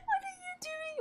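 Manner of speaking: very high squealing question; excited squeal. Real, not acted. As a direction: squeal it in disbelief. Fangirling reaction.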